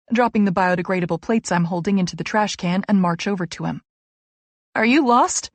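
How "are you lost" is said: calling out. A narrator's reading. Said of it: "I call".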